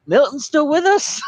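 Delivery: high-pitched incredulous question; mock disbelief, voice leaping up; surprised character quote. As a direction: pitch it way up, as if baffled. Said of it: "Bloodshot's like".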